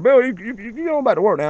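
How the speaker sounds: lightly mocking boss, pitched well up; imitating the boss. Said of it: "Bill said".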